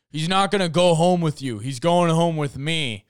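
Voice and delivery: mock-indignant butting in; possessive rival. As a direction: cut in to claim him, mimicking a rival.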